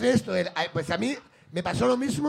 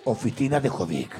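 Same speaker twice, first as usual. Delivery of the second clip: much lower, formal receptionist voice; fake office voice; 11 semitones lower than usual. Jovic answering as his own office, much lower. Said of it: "cambia la voz".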